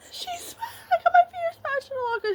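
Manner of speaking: high, teary voice breaking into a sob-laugh; half-laughing, half-crying retelling; retold crying. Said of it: "bawling my eyes out".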